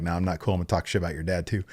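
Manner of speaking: a lightly voiced menacing Roman; mock low Roman. Vlogger retelling Roman's promo, low and quiet. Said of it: "Roman's like".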